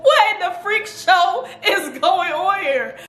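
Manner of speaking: high, squeaky voice breaking into laughter; laughing while talking. Real, not acted. Laughing while retelling her shock.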